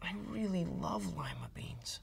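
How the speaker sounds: soft whispered confession. A narrator's reading. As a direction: admit it in a hushed whisper.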